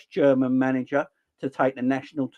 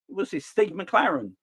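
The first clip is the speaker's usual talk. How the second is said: ordinary talk, nothing marked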